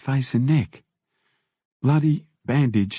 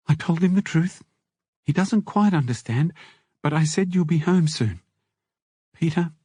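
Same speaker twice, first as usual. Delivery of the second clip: higher woman's voice, tender news; Mimi, gentle wife; 6 semitones higher than usual. A narrator's reading. Wife telling jailed husband she's pregnant, higher tender voice. Wife's long phone speech.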